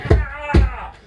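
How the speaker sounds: sudden high yelp of panic; a startled high cry as he loses it; freak-out yell. Acted. Freaking out, yelling.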